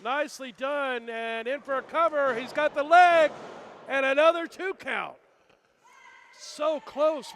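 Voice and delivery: loud play-by-play call; wrestling commentator. Real, not acted. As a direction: call the cover live. Live play-by-play of a near fall.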